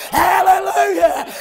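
high, loud, drawn-out hallelujahs; preaching at full force. Acted. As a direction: ring it out to the back pews. Sermon climax, shouted hallelujahs.

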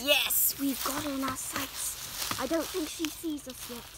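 quiet stalking voice; hushed. Acted. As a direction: speak low so she won't hear. Sneaking up on the animal.

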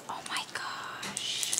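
gasp, then slow whisper; very soft, slow whispered wonder; awed whisper. Real, not acted. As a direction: breathe it out, stunned and hushed. Overwhelmed by next gift.

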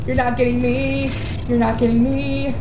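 normal talking voice

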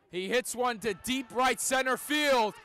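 roaring grand slam home run call; radio baseball announcer. Real, not acted. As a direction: yell it out as the ball leaves the park.

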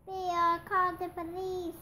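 high, loud storybook yell. A narrator's reading. Townsperson calling for police on seeing a bear, yelling. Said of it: "someone yelled".

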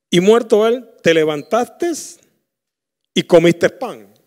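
loud, rising preacher voice; fiery sermon delivery; preaching at full force. Acted. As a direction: drive the story home from the pulpit. Preaches the servants' question.

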